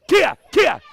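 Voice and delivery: two sharp, loud, high kiai shouts; double kiai. Shown as a demonstration. Instructor demoing both strikes for students: two loud kiais. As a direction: bark out a kiai with each hit. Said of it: "Kia loud in each of them".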